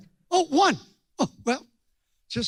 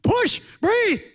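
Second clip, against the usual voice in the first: higher, louder yell of push and breathe; yelling orders; 11 semitones higher, 9 dB louder than usual. Retold or imitated. Preacher yelling at pregnant woman in parking lot, loud shout. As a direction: shout it at the mother like a movie doctor. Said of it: "So I'm yelling at the pregnant woman".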